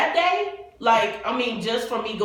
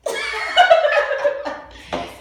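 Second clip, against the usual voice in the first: loud high laugh; 5 dB louder than usual. Real, not acted. Laughing at the mic gain.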